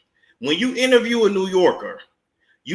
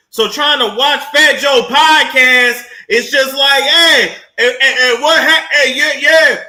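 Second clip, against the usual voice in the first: loud New Yorker impression; 10 semitones higher, 7 dB louder than usual. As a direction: bellow come on son like a loud New Yorker. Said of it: "you don't know if they yelling".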